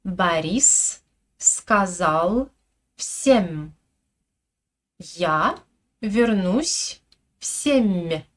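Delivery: slow, over-articulated Russian; exaggerated Russian. Shown as a demonstration. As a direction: spell out each sound slowly. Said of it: "J'exagère un peu la prononciation". (russe exagéré, très lent et appuyé)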